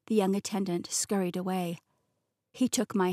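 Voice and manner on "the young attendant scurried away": plain reading voice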